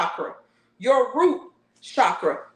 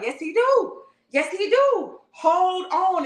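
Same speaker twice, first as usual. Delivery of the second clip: high insistent spirit; 7 semitones higher, much slower than usual. Acted.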